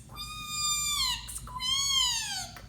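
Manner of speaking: very high tiny squeaks; mouse squeaks. Acted. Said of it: "That mouse squeaks".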